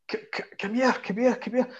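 high-pitched, stammered mimicked beckoning; calling friends over. German fan summons his pals, excited mimicked call.

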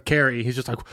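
ordinary talk

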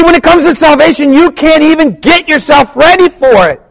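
very high, loud pulpit cry; full-force preaching. Acted. Preacher tells church they can't ready themselves, full-force preaching. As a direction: drive it home at the top of the voice.

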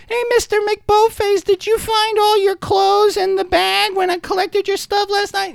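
put-on small boy piping up to McBoface; little Timmy. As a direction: chirp it like an eager little boy. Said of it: "the little Timmy comes out".

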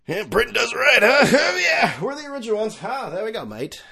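high, loud mock Australian accent. Mocking British tabloids in a hammed-up Australian accent. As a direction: ham up an Australian mate. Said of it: "That's Australian".

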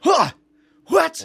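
sharp high shocked yelp; surprised. Real, not acted. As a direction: react in sudden disbelief. Host hears the joint show news.

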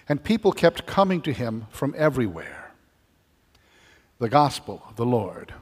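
formal, deliberate proclamation; gospel reading. Acted.

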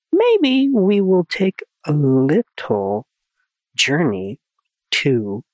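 slow, mysterious teaser voice; drawn-out cryptic tease; riddle hint voice. Acted. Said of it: "I didn't quite get it".

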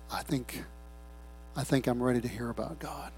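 very soft, low, halting confession; hushed surrender. Acted. Worn-out sinner ready to call on the Lord, hushed. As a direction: barely voice it, pausing between phrases. Voices a penitent ready to return.

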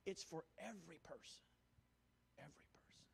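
drops to a soft whisper; preacher whispering the point home; hushed. Real, not acted. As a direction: bring it down to a hush after the shout. Sermon point softened after climax.

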